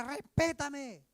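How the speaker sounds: slow, loud "¡Respétame!" drawn out; husband's furious demand for respect; angry shout. Retold or imitated. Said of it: "un esposo grita en la casa". (esposo grita furioso en casa)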